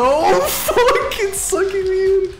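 high panicked yelp. Real, not acted. Caught by game fire.

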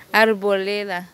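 "Arboleda" said slowly with a clear d; "Arboleda" corrected, firm and clear. Shown as a demonstration. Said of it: "No, hombre".

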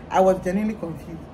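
ordinary delivery

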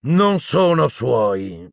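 high, drawn-out contempt; scornful Bane. A narrator's reading. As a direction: cut in scornfully, stretching the words slowly. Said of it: "lo interruppe sprezzante Bane". (sprezzante, lento e acuto)

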